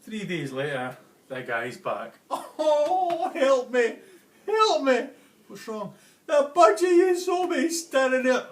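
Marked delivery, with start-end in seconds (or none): 2.3-8.5 high, loud, frantic cry for help; panicked plea. Acted. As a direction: plead in a high voice, near hysterics. The panicked customer comes back.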